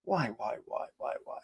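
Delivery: very soft, fading to a whisper; baffled muttering. Real, not acted. Streamer despairing over the news wording, hushed bafflement. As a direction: let the repeats sink away into breath.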